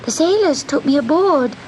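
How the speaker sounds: high, small kitten voice; pleading kitten. Acted. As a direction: plead with the witch like a tiny cat. Kitten answers the witch.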